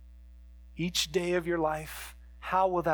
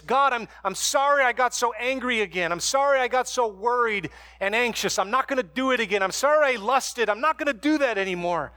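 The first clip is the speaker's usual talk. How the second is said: hurried, glib apology prayer, quick on the promises; wrong kind of prayer; 5 semitones higher, 4 dB louder, faster than usual. Shown as a demonstration. Pastor mocking a shallow repentance prayer, hurried and glib. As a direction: rattle off a mock prayer of empty promises. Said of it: "repentance doesn't sound like this".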